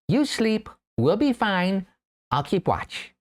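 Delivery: high little mouse voice. Acted. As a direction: voice the tiny mouse, pitched high. Mouse familiar reassures exhausted narrator.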